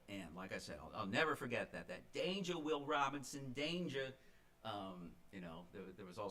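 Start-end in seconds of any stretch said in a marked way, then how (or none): 2.1-4.1 put-on lighter Boston accent echo, raised; a hint of Boston warning, recalled. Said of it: "in her Boston accent".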